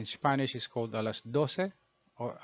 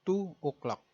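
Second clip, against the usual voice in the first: "two o'clock" with Filipino vowels, rolled r-less clipped ending; Tagalog-accented English; 6 semitones higher than usual. Shown as a demonstration. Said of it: "in Tagalog pronunciation".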